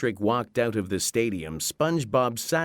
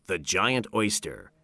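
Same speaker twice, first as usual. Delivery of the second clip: ordinary delivery, nothing marked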